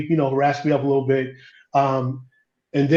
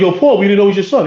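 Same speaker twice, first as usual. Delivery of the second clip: fast, louder and higher, caught out and backpedalling; quick, loud, higher apologetic blurt; flustered apology; 5 semitones higher, 6 dB louder, much faster than usual. Retold or imitated. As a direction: rush it out like nervous cops pleading with the boss. Cops apologize to angry father.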